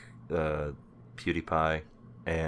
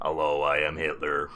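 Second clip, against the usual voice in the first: metallic cyborg voice; 3 semitones lower than usual. Pretend. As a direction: voice through a throat robot. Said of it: "a robot thing in his throat".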